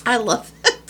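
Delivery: choking while laughing. Real, not acted. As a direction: talk through a choke. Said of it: "Sorry, I started choking".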